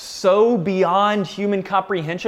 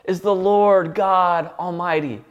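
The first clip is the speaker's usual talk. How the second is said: ringing angelic acclamation, slowing to a close; crying out; slower than usual. Retold or imitated. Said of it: "They are crying out".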